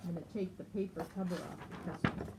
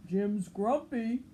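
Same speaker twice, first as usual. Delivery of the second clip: louder tattling voice; Norman telling; 3 semitones higher, 6 dB louder than usual. Acted. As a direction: report it like a tattletale. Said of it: "Norman told Lemur".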